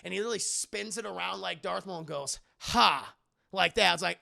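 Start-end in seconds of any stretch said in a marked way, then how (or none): 2.6-3.1 sharp loud "Ha!"; shout. Retold or imitated. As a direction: bark it out like Darth Maul. Darth Maul pose shout.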